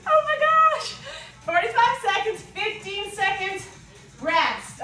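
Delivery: high, loud mock gasp of disbelief; shocked. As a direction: play the stunned student hearing the timer. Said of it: "You're like".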